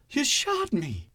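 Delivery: high, slow, stunned voice; shocked disbelief. A narrator's reading. Wounded hero faces the man who shot him, incredulous. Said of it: "he said in disbelief".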